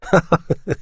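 high, sudden laugh; burst of laughter. Acted. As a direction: break out laughing. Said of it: "Il éclata de rire".

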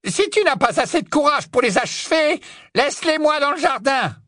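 very high, contemptuous witch voice; scornful order. Acted. Witch taunts her nephew's courage, scornful. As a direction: goad him shrilly for lacking courage.

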